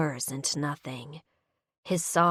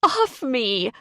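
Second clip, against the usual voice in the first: sob; 7 semitones higher, 5 dB louder than usual. Acted. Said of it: "I sob".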